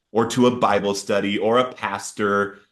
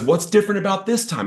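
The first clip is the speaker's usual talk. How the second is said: higher, pushed, frustrated question; retold yelling; 10 semitones higher than usual. Man yelling at God in the car, mock angry. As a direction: replay the outburst at God, half-heated. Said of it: "I start yelling at him".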